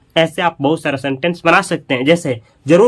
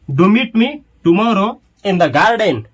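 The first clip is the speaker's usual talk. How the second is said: slow, emphatic dictation; 3 semitones higher, much slower than usual. Shown as a demonstration. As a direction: do meet me tomorrow in the garden said very slowly, word by word. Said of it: "तो अंग्रेजी क्या होगा". (धीरे-धीरे, शब्द-शब्द बोलकर)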